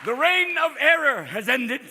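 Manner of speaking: high, loud, slow announcement; mock proclamation. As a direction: proclaim it grandly, taking your time. Mock proclamation of Bush's end.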